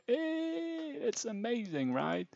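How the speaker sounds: squeaky high hey; high squeal. Acted.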